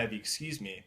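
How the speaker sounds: ordinary delivery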